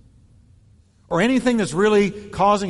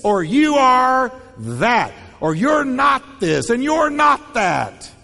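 higher and louder, accusing; mock argument; 7 semitones higher, 5 dB louder, slower than usual. Pastor acts out a couple's quarrel, higher and louder. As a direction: hurl each accusation like a spat. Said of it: "It goes like this".

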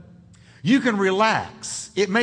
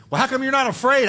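quick, higher, louder challenge; probing skeptic; 6 semitones higher, 3 dB louder, faster than usual. Acted. Skeptic challenging the calm believer, probing and quick. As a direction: push the question at them.

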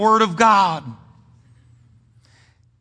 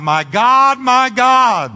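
loud, raised, drawn-out cry; 6 semitones higher, 6 dB louder, much slower than usual. Acted. Jesus on the cross calling to God, crying out. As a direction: cry it out to heaven, holding each GOD. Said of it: "he cried out".

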